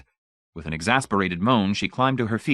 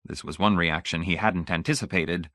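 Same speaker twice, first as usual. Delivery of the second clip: ordinary delivery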